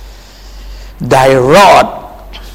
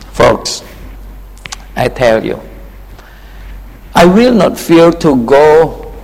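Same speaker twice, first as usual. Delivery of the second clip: usual speaking voice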